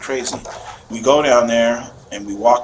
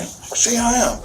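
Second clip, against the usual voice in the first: crying plea; 12 semitones higher, much slower than usual. Retold or imitated. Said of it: "but he's, like, crying".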